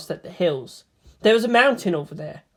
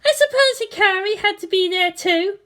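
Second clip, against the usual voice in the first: put-on high young girl's voice, teasing; voiced bratty girl; 14 semitones higher than usual. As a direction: play the haughty pilot girl. Asuka voiced by narrator.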